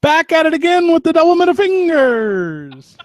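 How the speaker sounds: high, loud ringside call; wrestling announcer. Pretend. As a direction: hype it like a wrestling commentator. Joins announcer bit.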